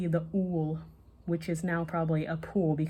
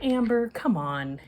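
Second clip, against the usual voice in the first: pleading; 5 semitones higher, 5 dB louder than usual. A narrator's reading. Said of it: "he pleads".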